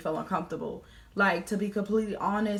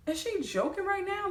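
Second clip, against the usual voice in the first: very high, is she joking right now; disbelief; 11 semitones higher than usual. Retold or imitated. Inner reaction to the confession, very high disbelief. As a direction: pitch shoots up in disbelief.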